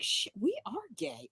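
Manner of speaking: very soft, stunned mutter; muttered realization. Retold or imitated. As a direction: breathe it out as it dawns on you.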